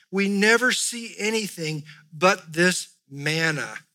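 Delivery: loud, petulant moaning of the Israelites; mock-complaining crowd, "only" pushed hard; whiny complaint. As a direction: whine like spoiled people wanting steak. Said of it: "Do you get the tone here?".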